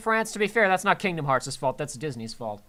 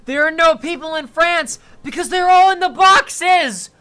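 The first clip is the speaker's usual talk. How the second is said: much higher, louder, slow exclamation; mock shocked shout; 8 semitones higher, 10 dB louder than usual. Joking about France, bursting out in mock horror. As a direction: yell it like a horrified discovery.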